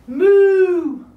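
loud drawn-out moo; long cow call. Pretend. What animal says moo.

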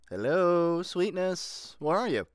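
loud, high searching call. Acted. Searching the house, loudly calling for Sweetness. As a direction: call out to find someone in another room.

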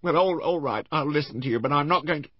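high-pitched, wavering between wary and agreeable; Mrs Rival. Acted. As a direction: play a hesitant woman haggling, pitched well up.

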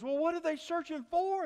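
loud, very high exasperated question; acted boss desperate for an answer; bewildered boss. Boss's bewildered final question.

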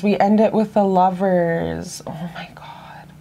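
slow breathless whisper of amazement; awed whisper. Real, not acted. Whispering in awe to viewers over the final card. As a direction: hush it like a secret miracle.

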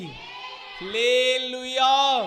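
drawn-out, very loud, high hallelujah; long shouted hallelujah. Real, not acted. Preacher leading the crowd, long shouted hallelujah. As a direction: stretch hallelujah into a huge slow shout. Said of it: "ఇంకా గట్టిగా చెప్పండి".

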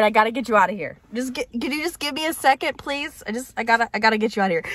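usual speaking voice

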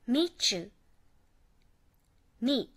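meet you、 said as two words; separate words. Shown as a demonstration. Teacher demonstrating the unlinked meet you、. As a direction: keep meet and you apart. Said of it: "のように別々の単語として発音せず".

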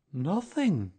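high, loud bear voice; emphatic bear. A narrator's reading. Bear answering donkey about his tail, emphatically.

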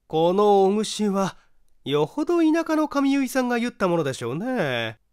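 high-pitched lady's comment; female character. Acted. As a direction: play a woman remarking on an old hairstyle. Narrator voices a woman.

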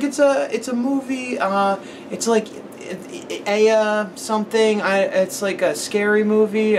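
put-on high, slow, earnest little kid. Retelling how the kid described the movie, in a high slow child voice. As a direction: talk like a small boy explaining a movie.

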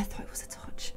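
soft whispered aside. Real, not acted. Streamer noticing a lever, whispering to herself. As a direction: breathe it out to yourself.

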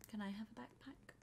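hushed, voiceless ask; whisper. Real, not acted. Asking another player quietly for a backpack, whispered. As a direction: whisper it shyly.

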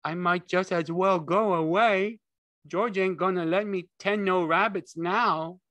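high, loud, plaintive simpleton; sorry Lenny. A narrator's reading. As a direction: give up like a hurt child. Lenny despairs.

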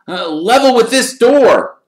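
loud, high exclamation; calling out. Acted. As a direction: call out the spot, pitched high. Ron calls out the spot.

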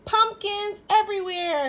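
overwhelmed by pumpkins; amazed delight. A narrator's reading. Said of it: "Spook says".

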